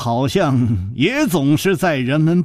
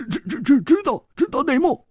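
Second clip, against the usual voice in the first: stammering shock; faster than usual. A narrator's reading. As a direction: stumble over the words in disbelief. 胡铁花惊得结巴. (惊得结结巴巴)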